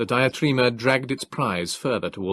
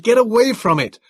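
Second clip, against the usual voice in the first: high, loud desperate shout; frantic loud order to flee; 12 semitones higher, 8 dB louder than usual. Acted. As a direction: yell a warning. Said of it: "I cried".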